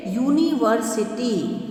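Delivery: drawn-out teacher demo of university; slow model word. Shown as a demonstration. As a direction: say university slowly, each syllable clear. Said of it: "जैसे".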